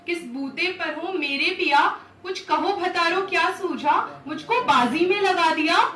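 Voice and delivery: loud, high demanding questions; reproachful Draupadi. Acted. Draupadi confronts her husbands over the wager, reproachful. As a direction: challenge them, driving each question upward.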